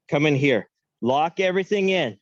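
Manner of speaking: ordinary talk, nothing marked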